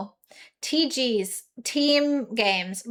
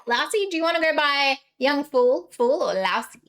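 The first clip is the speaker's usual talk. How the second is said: ordinary talk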